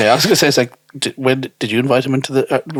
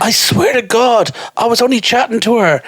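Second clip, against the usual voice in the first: mock pleading boyfriend; 9 semitones higher than usual. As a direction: swear innocence in a put-on voice.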